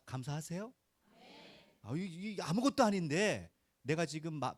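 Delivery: ordinary talk, nothing marked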